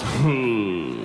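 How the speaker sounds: low "Who" that just slides out; barely form "Who", low and loose; slack-lipped. Retold or imitated. Comedian replaying the friend's answer, slack-lipped. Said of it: "It just slid out. Just".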